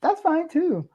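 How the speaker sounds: high, airy, unbothered shrug of a voice; breezy brush-off. Retold or imitated. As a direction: toss it off like it doesn't matter at all. Brushing off people who reject her.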